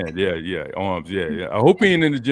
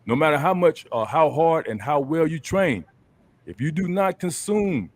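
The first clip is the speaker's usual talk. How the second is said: usual speaking voice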